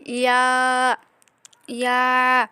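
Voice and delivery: ইয়া with the middle of the tongue on the palate; Arabic letter demo. Shown as a demonstration. Teacher showing students how to say ইয়া. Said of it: "জিহ্বার মধ্যখান উপরের তালুর সাথে লাগিয়ে".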